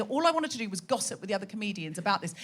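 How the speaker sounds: normal talking voice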